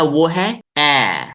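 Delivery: ए drawn out long and loud, mouth dropped open; vowel demo. Shown as a demonstration. Teacher to online class, loud slow demo of ए. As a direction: model the vowel ए slowly for the class to copy. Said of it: "सब कोई practice करो".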